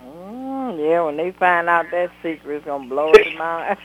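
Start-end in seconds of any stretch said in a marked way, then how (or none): none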